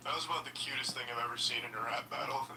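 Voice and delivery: usual speaking voice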